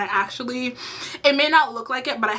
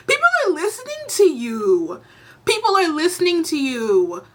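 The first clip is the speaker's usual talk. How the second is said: high, emphatic exasperated outburst; exasperated plea; 6 semitones higher than usual. Real, not acted. Vlogger scolding podcast hosts with big platforms, exasperated. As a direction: drive each word home, pitched high and falling.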